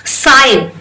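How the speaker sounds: साइन said with no g sound, falling tune; pronunciation demo. Shown as a demonstration. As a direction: model the word साइन cleanly for students. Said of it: "साइन ही बोल रही हूँ".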